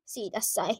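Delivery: ordinary talk, nothing marked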